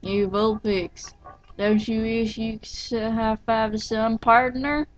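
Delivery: put-on country accent. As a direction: try on a country accent, dragging the words. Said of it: "How do I do a country accent?".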